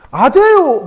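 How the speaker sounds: high, loud surprised yell; startled shout. Retold or imitated. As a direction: blurt it out like a startled kid. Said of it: "막 이러는 거예요".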